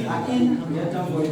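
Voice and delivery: "I ain't get time for you" in Liberian Koloqua, ain't and get run together. Shown as a demonstration. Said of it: "I say".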